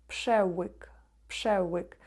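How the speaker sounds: пшевик with ł like English w; Polish ł. Shown as a demonstration. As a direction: say пшевик, its ł a w-sound. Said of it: "не тверду літеру В, а В".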